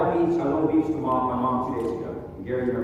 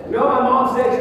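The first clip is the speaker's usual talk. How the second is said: excited lead-in; 9 semitones higher, 10 dB louder than usual. Real, not acted. Excited build to mom's quote.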